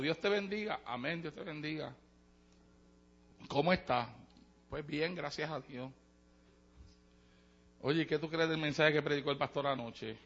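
hushed gossip. Acted. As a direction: keep it hushed like gossip in the pew.

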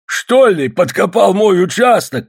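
high, loud accusing cry; old man's shout. A narrator's reading. Old man to the encroaching digger, accusing shout. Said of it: "кричал старик".

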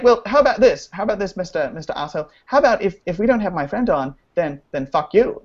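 high mock-British child voice; British orphan boy. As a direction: imitate a British orphan making demands. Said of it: "your British, like, little orphan boy".

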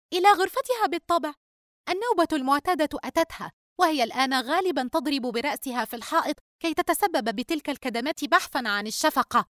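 high-pitched daughter voice, scornful; snippy younger daughter, dismissive. Acted. Daughter telling mother about sister's fit, scornful. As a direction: report on your sister with disdain, high.

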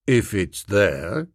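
slow, low grudging constable. Acted. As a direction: give it grudgingly, unconvinced. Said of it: "in a grudging way".

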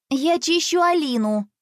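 snippy refusal; curt retort. A narrator's reading. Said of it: "отрезала девочка".

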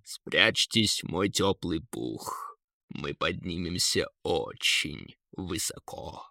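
low, slow, soft eagle; deep and unhurried, sheltering the riders; eagle's voice. A narrator's reading. (низкий мягкий голос орла)

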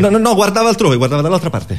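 high, rushed protest; panicked denial. Acted. Freud frantically denying to the analyst, panicked high voice. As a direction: scramble to deny it.

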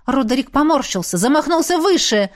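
ordinary delivery

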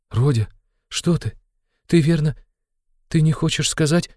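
very high, alarmed mother's voice; frightened. A narrator's reading. Mother alarmed by her son's words, frightened. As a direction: falter in short, high, anxious questions. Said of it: "начала было в испуге Пульхерия Александровна".

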